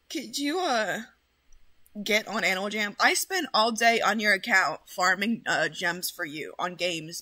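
ordinary delivery

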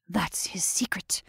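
soft voiceless whisper; quiet conspiratorial whisper. A narrator's reading. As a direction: hush it, a secret. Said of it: "speaking in a whisper".

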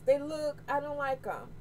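ordinary delivery

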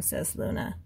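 plain reading voice

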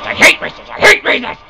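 frustrated outburst. Real, not acted. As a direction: grumble flaring into angry exclamation. Same words, temper bursting.

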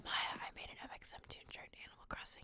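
soft ASMR whispering. Pretend. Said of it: "I'll do this all in A S M R".

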